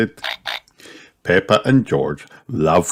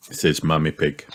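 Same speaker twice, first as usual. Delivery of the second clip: ordinary delivery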